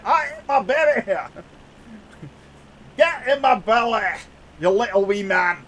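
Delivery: lightly voiced Scottish glutton; mock greedy Scottish threat; Fat Bastard quote. Streamer repeats the Fat Bastard line for chat. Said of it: "or fat bastard".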